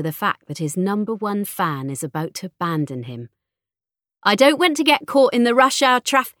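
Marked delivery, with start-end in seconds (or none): 4.2-6.3 high, loud nagging, cut off at the end; impatient fretting. A narrator's reading. Mother worried about rush hour traffic, loud and impatient. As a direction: fret loudly until interrupted.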